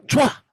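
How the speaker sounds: spitting sound. Retold or imitated. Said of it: "ludah itu loh".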